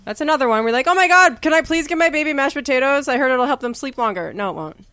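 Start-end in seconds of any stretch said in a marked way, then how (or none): 0.9-4.3 high-pitched, hurried mock plea; pleading parent. Parent begging to give baby mashed potatoes, mock pleading.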